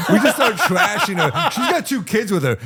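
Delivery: high laughing voice. Real, not acted. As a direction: laugh through the words. Laughing while retelling.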